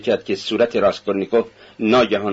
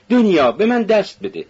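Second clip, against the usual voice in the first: higher, called down the hallway; a raised call to his sister; 8 semitones higher than usual. A narrator's reading. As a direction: call to her from the corridor. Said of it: "فریاد زد".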